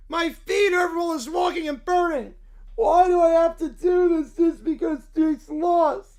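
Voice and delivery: put-on whiny angry little boy, shouting in bursts; furious child voice yelling at the town; Caillou tantrum. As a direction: throw a bratty tantrum as a spoiled kid. Caillou voice continues.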